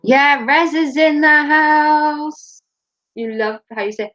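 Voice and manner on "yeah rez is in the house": slow, drawn-out hype call; slow mock MC call; announcer hype. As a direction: announce him like an MC. Announcing a returning friend.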